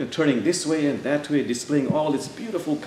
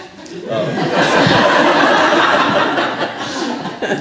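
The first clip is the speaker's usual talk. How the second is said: long breathy higher laugh; real laugh; 11 semitones higher, 9 dB louder than usual. Real, not acted. Teacher laughing before telling the birds' excuses, long laugh. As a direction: let a big laugh roll out.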